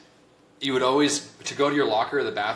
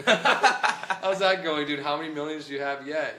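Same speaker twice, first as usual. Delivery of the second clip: mocking coworker; 5 semitones higher than usual. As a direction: stretch it out to rub it in.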